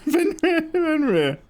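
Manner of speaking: high-pitched laughing call to a dog. Real, not acted. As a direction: sing the name way up, laughing.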